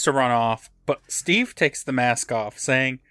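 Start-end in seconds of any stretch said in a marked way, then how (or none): none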